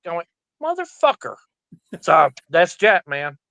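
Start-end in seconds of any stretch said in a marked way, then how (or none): 0.6-1.3 much higher pitched, playful indignant outburst; mock outraged yelling. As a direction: act fake offended at the friend. Calls back after being hung up.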